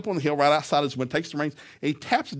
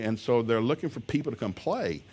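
usual speaking voice

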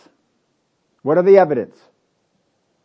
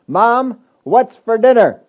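higher, louder call through the house; child calling out; 5 semitones higher, 6 dB louder, slower than usual. Acted. Child calls to mother about dinner, calling out. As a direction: call to Mom from another room. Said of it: "if you were to call around the house".

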